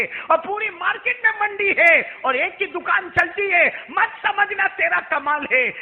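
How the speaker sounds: high, loud, rising sermon cadence; fervent preacher's climax; full-force preaching. Acted. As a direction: drive the lesson home to the crowd.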